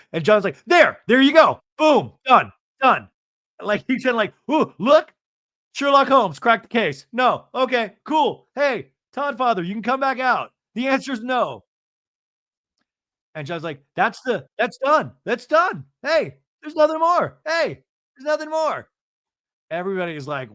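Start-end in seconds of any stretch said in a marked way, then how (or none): 0.7-3.1 loud, high, punched-out words; triumphant declaration. Retold or imitated. John declaring the matter done, in a triumphant shout. 4.5-11.6 high-pitched crowing; mock triumph. John summoning Todd back out, in high mock triumph. Mimics John's triumph, case closed. 13.9-18.9 high, squeezed protest; insistent retold voice. John insisting it is finished, in a high pleading voice.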